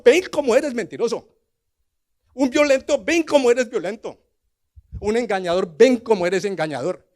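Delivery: ordinary talk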